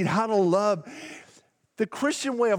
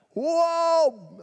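mock startled whoa; 9 semitones higher than usual. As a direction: jump back as if hit by fireworks. Congregation reacts to loud point.

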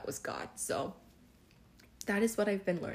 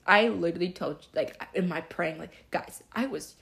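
much louder, eager emphasis; excited burst; 7 dB louder than usual. Real, not acted. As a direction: blurt it out with excitement.

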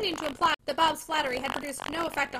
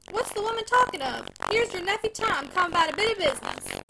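impatient retort; 5 semitones higher, 5 dB louder than usual. Acted. Husband retorts to wife.

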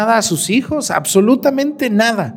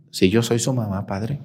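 meek mother; 8 semitones lower, 6 dB quieter than usual. Retold or imitated. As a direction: lower and softer, a timid reply. Mother answers the priest.

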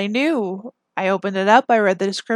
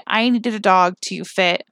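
ordinary delivery

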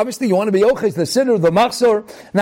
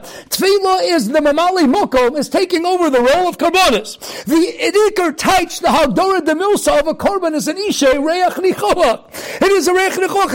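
fiery preaching; 5 semitones higher than usual. Acted. Preaching at full force.

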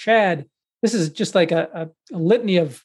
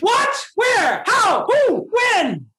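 very high squawked questions; high-pitched mock bewilderment; much slower than usual. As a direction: fire off each word in a silly high voice. Rapid mock-panicked questions to co-host.

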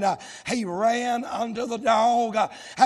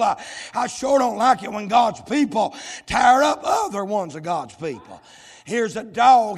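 full-force preaching; 5 dB louder than usual. Acted. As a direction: preach it hard and drive every line home. Preacher building again.

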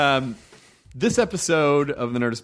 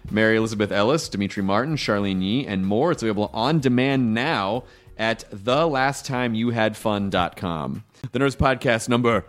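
usual speaking voice

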